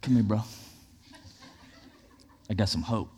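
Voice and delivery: low, hushed dealer's approach; street dealer pitch. Acted. Pastor playing a hope dealer, low hushed offer. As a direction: beckon someone over on the quiet. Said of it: "Be like".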